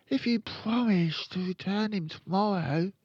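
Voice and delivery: put-on high-pitched little-boy voice; high boy character; young Fred. A narrator's reading. Fred gives in.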